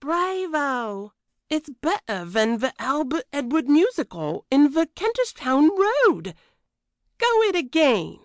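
high, excited cockney cook; crying out. A narrator's reading. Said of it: "she cried".

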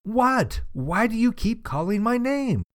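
high-pitched, exasperated mock shout; frustrated shout. A narrator's reading. Frustrated girl shouts to her mom, high mock shout. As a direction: yell it up high without real volume. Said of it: "and shouted".

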